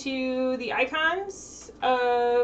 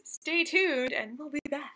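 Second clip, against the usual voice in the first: ordinary talk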